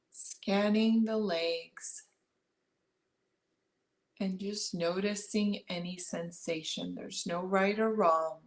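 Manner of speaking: calm meditation guide, long pauses between phrases; unhurried body-scan guidance, leaving space after each instruction; guided meditation. Acted. Guiding listeners to notice breath and body, guided meditation.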